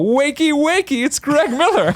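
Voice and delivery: high, slow, cheeky sing-song; sing-song wake-up call. Retold or imitated. Calling Jeremy Dunham at four in the morning: high, slow, cheeky sing-song. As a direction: tease him awake like a cheery alarm.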